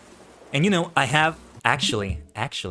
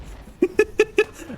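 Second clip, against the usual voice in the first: evil cackle. Acted. Sith villain cackling at his attack.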